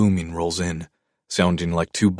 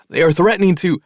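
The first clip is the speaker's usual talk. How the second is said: much higher, louder, cut off mid-word; panicked; 11 semitones higher, 6 dB louder than usual. A narrator's reading. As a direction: blurt it in a panic before being cut off.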